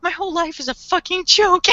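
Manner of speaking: high-pitched imitation of a broken man; hammed-up wail of disillusion; mock despair. Voicing Mikami in despair.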